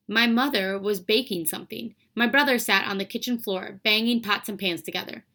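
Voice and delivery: plain reading voice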